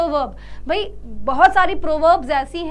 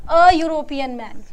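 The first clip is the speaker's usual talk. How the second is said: अ stressed and louder in अ European man; 6 dB louder than usual. Shown as a demonstration. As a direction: hit अ firmly, as the right answer. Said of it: "हम क्या काम में लेंगे?".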